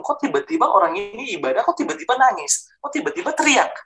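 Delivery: high-pitched retold bewilderment; bewildered. Newcomer puzzled by sudden crying in church, bewildered, rising high. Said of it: "Saya bingung".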